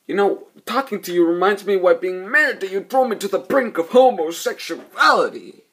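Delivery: snide jab at the ex-wife, played loud; sarcastic retort. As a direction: deliver the insult with hammed-up scorn. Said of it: "And he goes".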